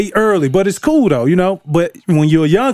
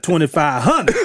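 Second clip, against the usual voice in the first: ordinary talk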